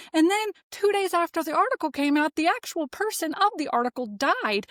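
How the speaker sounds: high-pitched furious rant at the Etsy witches; rising angry outburst, voice jumping high; real pissed-off rant. Real, not acted. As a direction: let the real anger spill out, pitch climbing. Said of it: "Yes, I'm pissed".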